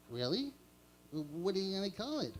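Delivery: lightly voiced little bear; curious sidekick; Boo Boo voice. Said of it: "Yogi and Boo Boo".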